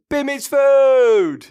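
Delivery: big drawn-out announcer shout; announcer call-out. Acted. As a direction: introduce him like a boxer. Host announcing co-host.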